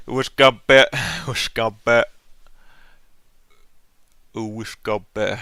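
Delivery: loud mock Swedish accent, words squashed together; exaggerated Swedish accent. Animator laughing at own line, exaggerated Swedish accent. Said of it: "incredibly Swedish accent in this".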